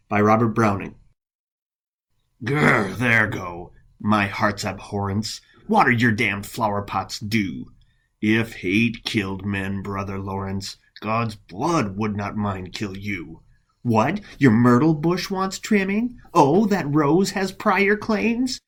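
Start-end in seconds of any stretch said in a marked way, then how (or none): 2.4-13.4 low, seething spite held under the voice; jealous monk. Acted. Monk curses Brother Lawrence as he gardens, low seething spite. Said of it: "in the voice of a jealous monk". 13.8-18.6 rising, scornful questions; mock questioning. Monk quizzes rival over trimming his myrtle, mock concern.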